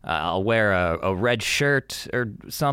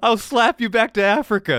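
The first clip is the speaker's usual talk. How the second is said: mock movie line; 11 semitones higher, 5 dB louder than usual. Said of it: "he was like".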